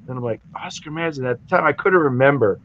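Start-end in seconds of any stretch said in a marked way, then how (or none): 0.6-1.2 much softer, musing echo of a name; puzzled repeat. Retold or imitated. Newcomer trying to remember the name, puzzled. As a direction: say it to yourself, trying to place it.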